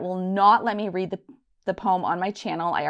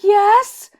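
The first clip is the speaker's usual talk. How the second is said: much higher, loud hopeful yes; eager yes; 14 semitones higher, 13 dB louder than usual. Retold or imitated. Expecting a proposal at dinner, an eager hopeful yes. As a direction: leap at it, sure the proposal is coming. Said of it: "And I'm like".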